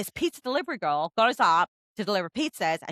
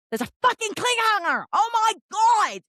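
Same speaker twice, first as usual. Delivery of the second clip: high, loud exasperated outburst; outraged shout; 13 semitones higher, 5 dB louder than usual. Retold or imitated. Reader yelling about a cliffhanger ending, outraged shout.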